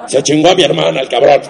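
gruff cantina-singer Frida; raspy Chavela-style voice. Retold or imitated. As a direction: curse Diego like an old cantina singer. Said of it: "así como [name]".

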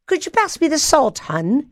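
high-pitched put-on British accent; playful mock British politeness; imitated British husband. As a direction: mimic a British husband at dinner. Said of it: "he'll say things like".

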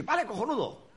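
high, hammed-up approval; imitating director's approval. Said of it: "O dice".

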